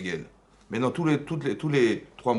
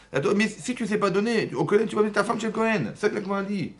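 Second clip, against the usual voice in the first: voix nettement plus haute, pressante; alarmed emphasis; 9 semitones higher than usual. Real, not acted. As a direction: avertis la classe avec urgence. Alarmed about the Gemara warning. (mise en garde aiguë et pressante)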